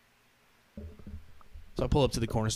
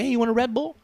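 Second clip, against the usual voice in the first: higher, eager offer; cheerful passenger; 9 semitones higher, 3 dB louder, faster than usual. Retold or imitated. Passenger offering the driver a drink, higher and eager. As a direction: offer it like a friendly goofball. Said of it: "he's all cheerful".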